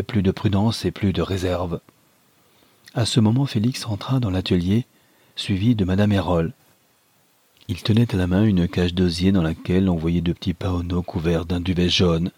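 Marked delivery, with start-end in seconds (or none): none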